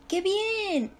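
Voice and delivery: "qué bien" high and drawn out; emphatic exclamation. Shown as a demonstration. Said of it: "Es enfático".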